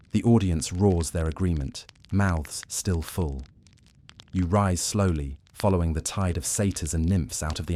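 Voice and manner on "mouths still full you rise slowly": ordinary delivery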